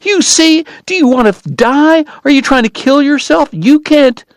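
high, loud tearful cry; weeping, frantic. Acted. Kate cries at coughing Doc, high, tearful and frantic. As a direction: sob it out in panic. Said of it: "weeping and frantic".

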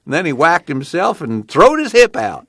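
put-on high-pitched, gleeful old yarn-spinner; old father telling a tale. A narrator's reading. Pa telling Ma about Grandpa's antics, gleeful. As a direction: spin the tale in a high folksy voice.

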